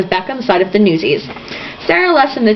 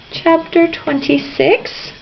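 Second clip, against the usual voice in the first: drawn-out, pitched-up question; slow questioning; 8 semitones higher, much slower than usual. Real, not acted. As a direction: stretch it out like you're unsure. Reader checking the chapter.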